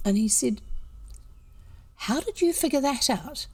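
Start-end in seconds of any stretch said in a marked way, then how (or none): 1.9-3.5 mildly astonished, pitched higher; surprised. Retold or imitated. Stranger amazed that their home area was guessed, surprised. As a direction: retell someone's baffled surprise, voice lifted.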